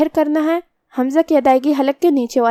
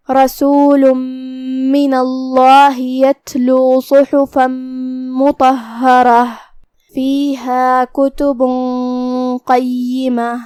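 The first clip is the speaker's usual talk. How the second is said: Quran recitation; much slower than usual. Acted. Teacher recites the verse to students, slow melodic chant. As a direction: chant the verse slowly as in tajweed.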